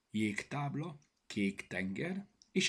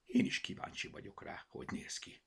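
ordinary delivery

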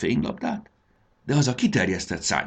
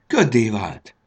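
joyful exclamation, a little louder; rejoicing; 3 semitones higher, 5 dB louder, slower than usual. A narrator's reading. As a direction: celebrate it with a bright little cheer. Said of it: "örvendezett Fanny". (örvendezve)

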